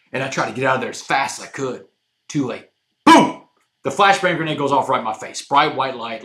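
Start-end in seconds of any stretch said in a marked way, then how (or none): 3.0-3.4 loud high blast sound, a sudden burst; imitated boom. Telling Randall the grenade went off, a loud burst. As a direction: shout the explosion out. Flashbang goes off.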